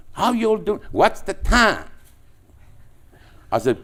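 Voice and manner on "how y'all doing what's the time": much higher, louder mock Southern drawl; hammed-up Southern American accent. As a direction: play the Atlanta caller, drawn out. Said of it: "the Southern people have a drawl".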